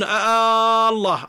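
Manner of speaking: "آه الله" chanted slowly with a long questioning "آه" before الله; drawn-out adhan call, "آه الله"; adhan-style wrong hamza. Shown as a demonstration. Teacher demonstrating the muezzin's wrong call, chanted slowly. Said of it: "يدخلون همزة الاستفهام على اللفظة العظيمة".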